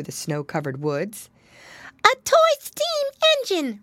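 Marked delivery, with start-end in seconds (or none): 2.0-3.8 put-on very high, excited child voice; piggy boy. A narrator's reading. Piglet naming his Christmas wish, high eager voice. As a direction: announce the wish in a loud little squeak. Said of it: "replied Flop Ear".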